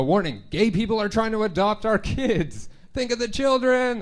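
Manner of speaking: high, alarmed hysterical warning; a hammed-up, alarmed reading of a hateful note; mock panicked bigot. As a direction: ham up a frightened bigot's plea. Mocking homophobic note writer.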